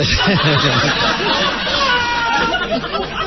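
long high burst of laughing. Real, not acted. Crowd laughing at the soldier's answer.

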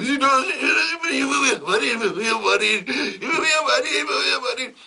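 high, loud mock sobbing maid; comic high-pitched blubbering; hammed-up wailing. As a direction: wail it like a hysterical maid. Maid Jenny wailing again.

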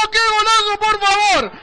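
high ecstatic shout; goal scream. Real, not acted. Goal just scored, shouting.

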